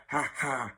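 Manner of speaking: put-on two-beat "ha ha"; short gloating stage laugh; mocking laugh. Matron laughing at her jibe at George, mocking.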